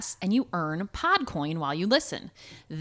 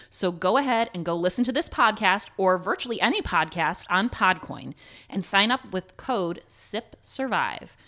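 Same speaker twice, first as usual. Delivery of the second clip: usual speaking voice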